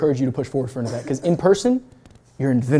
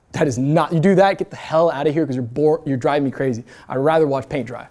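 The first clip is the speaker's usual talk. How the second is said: usual speaking voice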